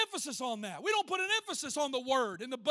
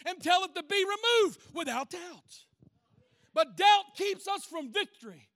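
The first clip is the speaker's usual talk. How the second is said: raised sermon voice with hammered phrases; impassioned preaching; 4 semitones higher, 7 dB louder than usual. Real, not acted. Preacher declaring faith over mountains of trouble, impassioned preaching. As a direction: proclaim it with conviction to the church.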